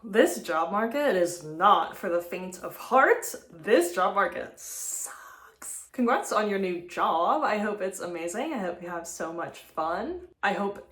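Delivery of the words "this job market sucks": breathy whispered aside. Real, not acted. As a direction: drop to a whisper. Aside after voicemail.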